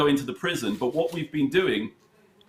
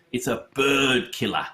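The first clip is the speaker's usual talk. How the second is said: put-on London accent. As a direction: voice a London prisoner's slang lightly. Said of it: "very London accent".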